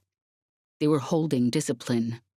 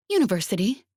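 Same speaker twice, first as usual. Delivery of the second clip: bright upward lift, lightly voiced; cheerful; 6 semitones higher, faster than usual. A narrator's reading. Jez calling back to Radek, cheerful rising reply. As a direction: toss it back cheerfully over the shoulder. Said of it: "said Jez cheerfully".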